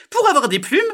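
very high, loud bird voice; know-it-all magpie. Acted. Magpie fools the frog, high loud bird voice. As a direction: squawk it out as a magpie.

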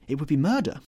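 loud, high-pitched horror; urgent, appalled exclamation; desperate cry. A narrator's reading. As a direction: cry it out in alarm. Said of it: "he cried".

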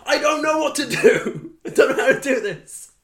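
high, pleading mock panic. Overwhelmed Welsh tutor on set, panicking high and pleading. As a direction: fake a frantic plea.

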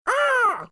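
high shrieking crow caw; raspy cawing crow. Retold or imitated. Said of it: "A crow flies out by the sound of it".

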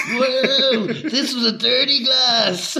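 high-pitched, drawn-out whooping; mock wild partygoer. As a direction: imitate someone hammed-up and giddy, voice way up. Mocking people drunk on milk.

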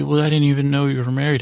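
ordinary talk, nothing marked

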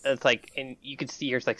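normal talking voice

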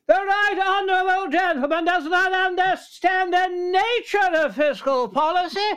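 high, slow, commanding Commons speech; Thatcher parliamentary voice. Shown as a demonstration. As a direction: lecture the House like Thatcher. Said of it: "So this is her parliamentary voice".

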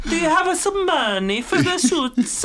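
very high, pleading cartoon Italian accent; hammed-up squealy Italian with extra vowels; mock Italian, begging up high; Jared Leto. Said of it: "That is genuinely how he comes in".

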